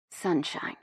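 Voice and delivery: soft, hushed male murmur; whispered. A narrator's reading. Man naming her after her necklace, whispered. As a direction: barely voice it, very softly. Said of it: "he whispers".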